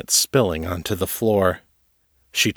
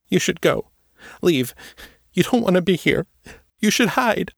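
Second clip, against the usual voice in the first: high, urgent softer warning; more softly; 10 semitones higher than usual. A narrator's reading. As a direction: warn her gently but urgently. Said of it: "more softly".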